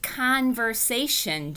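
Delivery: slow, lightly voiced teacher correcting; teacher's voice. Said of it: "she said".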